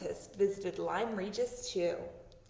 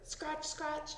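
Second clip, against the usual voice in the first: scratching sound; 7 semitones higher, 5 dB quieter than usual. Acted. Dog scratching in story.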